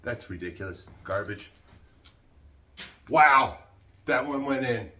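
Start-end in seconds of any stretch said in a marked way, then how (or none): 3.1-4.9 sudden loud wow; loud, impressed exclamation; amazed outburst. Real, not acted. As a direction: burst out in amazement. Trash throw lands in the can.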